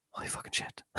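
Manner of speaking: breathless whispered swear; shocked whisper. Retold or imitated. Seeing strangers watching her sing, whispered stunned swear. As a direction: hiss it under your breath in shock. Sees crowd suddenly watching.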